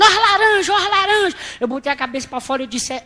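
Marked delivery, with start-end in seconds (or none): 0.0-1.4 high, loud hawker's cry of oranges; street vendor's call. Retold or imitated. Fruit seller on the bus hawking oranges, loud high street cry.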